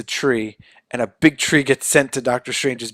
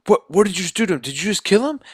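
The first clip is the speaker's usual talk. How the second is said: higher, shocked questioning; a retold gasp of disbelief; alarmed Sandman; 7 semitones higher, faster than usual. Sandman confronting Spider-Man after the zap, shocked and higher. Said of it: "Sandman's like".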